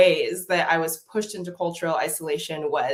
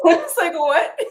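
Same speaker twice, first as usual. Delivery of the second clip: high, loud laughing "what"; mock outrage through laughter; laughing disbelief; 12 semitones higher, 7 dB louder, slower than usual. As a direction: laugh out the shocked question. Laughing at retold shock.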